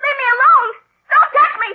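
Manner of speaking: put-on a child yelling in terror; scared boy shouting at old Sadie not to touch him; panicked shout. Boy fending off old woman.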